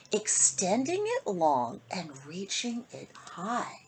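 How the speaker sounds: put-on lilting rhymed read-aloud for kids; storybook reading. A narrator's reading. Host reading a bedtime rhyme to kids, lilting. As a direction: read the rhyme to children, sing-song. Reads picture book to children.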